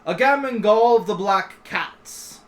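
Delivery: slow, loud formal announcement; guard announcing. Acted. Guard presents a visitor to the king, slow loud announcement. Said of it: "The guard then says".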